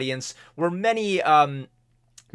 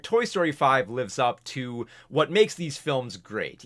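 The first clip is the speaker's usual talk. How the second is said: usual speaking voice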